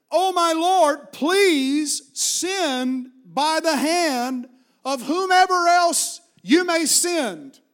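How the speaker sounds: slow, high, drawn-out plea; loud pleading. Acted. Moses begs the Lord to choose someone else, pleading. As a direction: beg loudly, stretching every word.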